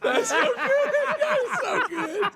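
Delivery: high squealing laugh through words; cracking up, slow and squeaky. Real, not acted. Laughing hard at caller.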